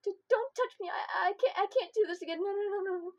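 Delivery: high, loud, stammering refusals; panicked outburst. Acted. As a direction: shake it out in raw panic. Jolted awake, panicking.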